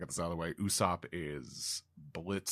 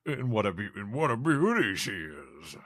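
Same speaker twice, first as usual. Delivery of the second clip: a touch louder, lightly voiced admiring giant; 2 semitones higher, 6 dB louder than usual. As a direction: savor the word beauty. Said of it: "Yarrow goes".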